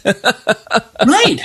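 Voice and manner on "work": high incredulous retort. Retold or imitated. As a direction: scoff at the director in disbelief. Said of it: "And it's like".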